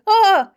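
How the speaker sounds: high startled yelp, hammed up; shocked yelp. Husband hit with cold water, high shocked yelp. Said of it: "while he's like".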